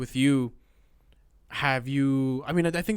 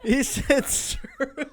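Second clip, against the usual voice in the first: laughing; 11 semitones higher, much slower than usual. Real, not acted. Laughing while repeating.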